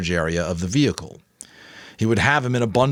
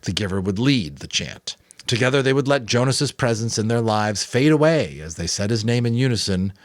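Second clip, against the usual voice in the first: usual speaking voice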